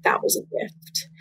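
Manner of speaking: a soft breathy whisper of God's gentle words; hushed whisper. Retold or imitated. God speaking to her in the bathtub, whispered. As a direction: let it fall to a whisper, tender and low.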